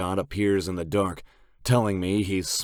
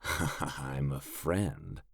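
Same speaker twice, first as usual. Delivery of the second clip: very slow, much softer sinister line; lightly voiced menacing stranger; creepy villain; 3 semitones lower, 8 dB quieter, much slower than usual. A narrator's reading. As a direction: purr it like a threat. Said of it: "villainous laugh".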